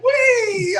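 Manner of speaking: playful high-pitched wee; high squeaky wee. Retold or imitated. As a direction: squeal it like a slipping cartoon. Imitating legs giving way.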